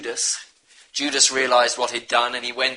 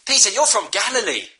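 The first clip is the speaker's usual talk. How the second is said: higher, pointed accusation; accusing bystander; 10 semitones higher than usual. Retold or imitated. Bystanders in the courtyard accusing Peter, higher pointed challenge. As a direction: call him out as a Galilean, voice raised high. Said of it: "some said to Peter".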